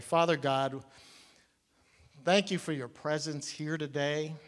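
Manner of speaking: gentle pastor's prayer. Acted. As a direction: pray quietly and humbly over the room. Leads the congregation in prayer.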